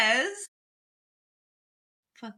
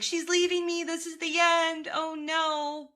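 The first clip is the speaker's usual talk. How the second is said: higher, hammed-up despair; mock dramatic husband; 7 semitones higher than usual. Imitating Kyle being too dramatic about separation, hammed-up and higher. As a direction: play him as a drama queen wailing. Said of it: "Kyle is too dramatic".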